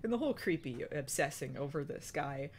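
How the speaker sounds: ordinary delivery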